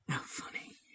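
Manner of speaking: soft unvoiced whisper to herself; whispered aside. Real, not acted. Amused by a chat comment, whispered under breath. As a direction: breathe it almost silently.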